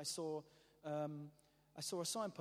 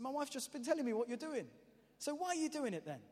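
curious husband; 8 semitones higher than usual. Retold or imitated. Husband questioning the walker.

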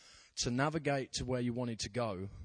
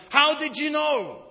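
much higher and louder, slow, breaking with emotion; man in tears, stunned, slow and loud; tearful disbelief; 14 semitones higher, 15 dB louder, slower than usual. Retold or imitated. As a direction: ask it as if tears just burst out. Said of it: "burst into tears and goes".

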